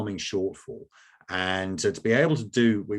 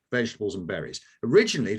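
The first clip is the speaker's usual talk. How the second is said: ordinary talk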